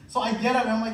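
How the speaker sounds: normal talking voice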